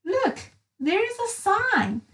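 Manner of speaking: high, eager little voice; eager pointing out. Acted. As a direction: point it out excitedly. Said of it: "said little Miss Dotty".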